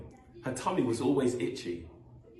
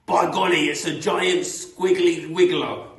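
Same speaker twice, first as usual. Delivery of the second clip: loud, higher mock alarm; Mister Twit; 7 semitones higher, 10 dB louder than usual. Mister Twit scaring his screaming wife, loud mock alarm.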